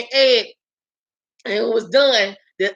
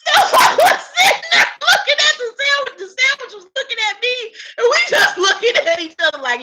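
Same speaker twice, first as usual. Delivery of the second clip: laughing while telling; 9 semitones higher, faster than usual. Real, not acted. Laughing through the sandwich story.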